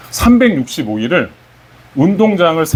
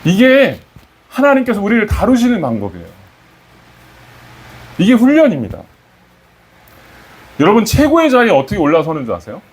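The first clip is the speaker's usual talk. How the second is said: raised, emphatic sermon line; a touch of fervent preaching; 6 semitones higher than usual. Acted. Pastor declaring God's training to congregation, emphatic. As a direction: drive the lesson home, slightly higher.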